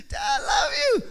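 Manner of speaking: put-on very high, sugary kid's voice; butter dad up like a little boy; sweet child voice. Son sweet-talking dad for gifts.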